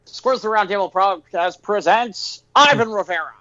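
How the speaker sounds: booming circus announcer call; ringmaster. Acted. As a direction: announce it like a big-top ringmaster. Podcast intro, circus announcer call.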